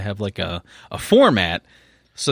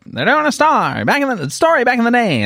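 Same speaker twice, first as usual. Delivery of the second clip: much higher squeaky put-on voice; high character voice; 13 semitones higher, 3 dB louder than usual.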